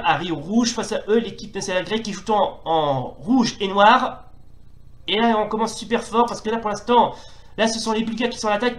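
lively football play-by-play; sports commentary. Acted. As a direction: call the match live like a TV commentator. (commentaire sportif en direct)

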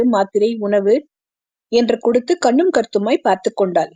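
usual speaking voice

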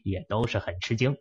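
ordinary delivery

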